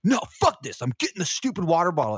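sudden high, loud yell of refusal; defiant outburst. Retold or imitated. To his girlfriend on the volcano, refusing to leave the bottle, high and loud. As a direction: snap back in a retold tantrum. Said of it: "And I was like".

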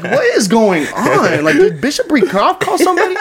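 very high, loud, slowed disbelief; astonished outburst climbing into a laugh; bewildered. Retold or imitated. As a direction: squeal it in comic bewilderment. Retells his bewilderment at the fireside.

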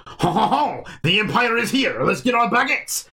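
hammed-up French accent with a haughty laugh; mock French accent. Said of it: "Gobi or Cham come up in this episode".

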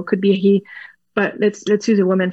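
ordinary talk, nothing marked

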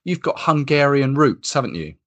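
normal talking voice

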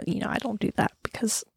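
quick hushed aside to herself; whispered aside. Real, not acted. Talking to viewers, she whispers a quick aside. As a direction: drop to a whisper for a self-defending aside.

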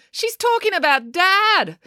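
very high, drawn-out astonishment; astonished exclamation. A narrator's reading. April realising who the stranger is, astonished. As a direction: blurt it out, high and slow. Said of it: "exclaimed April".

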